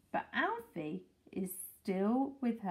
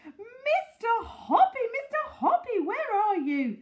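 excited shouting; 12 semitones higher, 7 dB louder than usual. A narrator's reading. Mrs Silver calling up to Mister Hoppy, shouting. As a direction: shout up for him, high and urgent. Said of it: "she was shouting".

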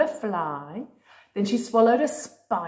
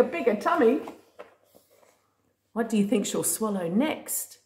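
usual speaking voice

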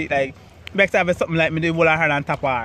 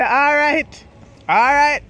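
excited; 8 semitones higher, 5 dB louder, much slower than usual. Retold or imitated. Retold elated reaction.

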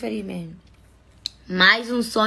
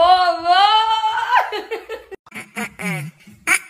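singsong laugh; 10 semitones higher, 5 dB louder than usual. Real, not acted. Laughing after cheering.

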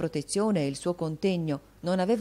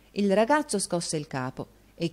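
ordinary delivery, nothing marked

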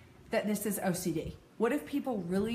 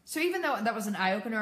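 ordinary delivery

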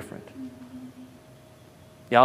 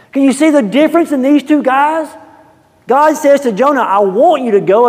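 high, loud preaching; 12 semitones higher, 11 dB louder than usual. Acted. Preacher to congregation, high and loud. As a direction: press the contrast home.

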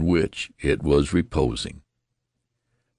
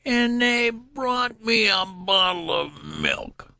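much higher, slow indignant complaint; shrill protest; slower than usual. Acted. Old newborn protesting about the milk, shrill. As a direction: rise into an outraged squeak. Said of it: "his voice rose to a shrill rise of protest".

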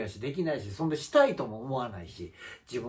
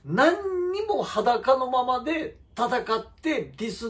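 higher, slow and drawn out, building the point; higher, slowed, indignant emphasis; heated emphatic rant; 10 semitones higher, 3 dB louder, slower than usual. Real, not acted. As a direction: lean hard on each word in indignation. Heated rant about rival streamer.

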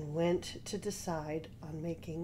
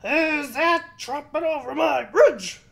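loud, high troll roar; 7 semitones higher, 11 dB louder than usual. A narrator's reading. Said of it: "roared the troll".

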